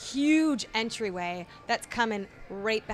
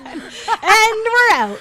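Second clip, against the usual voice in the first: laughing sign-off; 12 semitones higher, 14 dB louder, much slower than usual. Real, not acted.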